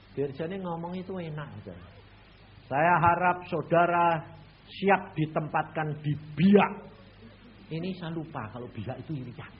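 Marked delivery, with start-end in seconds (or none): 2.7-6.7 slow, louder official announcement; formal official. Retold or imitated. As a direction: speak like a director-general. Director-general speaks formally. (dirjen bicara resmi, lambat dan tegas)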